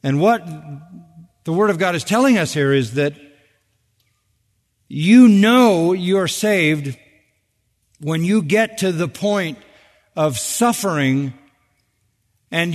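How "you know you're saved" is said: drawn out and louder, hammering it home; slow, forceful preacher's stress; slow, loud emphasis. Real, not acted. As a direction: lean hard on every word, slowly.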